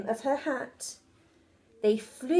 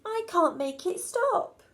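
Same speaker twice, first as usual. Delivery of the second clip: panicked cry; 7 semitones higher than usual. Acted. Betty crying to Mum about the overflowing cauldron. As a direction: wail it for help. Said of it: "cried Betty".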